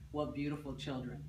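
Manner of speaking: plain reading voice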